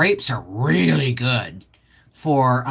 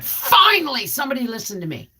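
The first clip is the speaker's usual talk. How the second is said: high, relieved cry of "finally", then a fast drop; relieved exclaim; 8 semitones higher than usual. Retold or imitated. Reacting to the movie's end, a relieved high exclaim. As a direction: exclaim it as if finally heard at last. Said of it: "I went".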